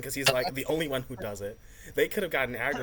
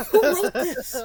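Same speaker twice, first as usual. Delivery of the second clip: very high, loud cry through laughter; incredulous hysterics; 6 dB louder, slower than usual. Real, not acted. Viewer appalled at the episode's writing, in incredulous hysterics. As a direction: wail it, helpless with real laughter.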